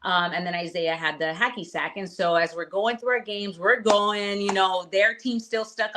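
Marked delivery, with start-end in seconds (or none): none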